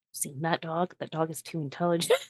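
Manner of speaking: very soft, suspicious murmur; hushed confiding. Acted. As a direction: confide a suspicion under your breath. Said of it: "she just says".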